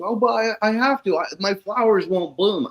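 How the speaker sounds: high pleading protest in imitation; mock whiny client. Retelling the client's protest, high mock plea. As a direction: play the client begging to keep his poisons.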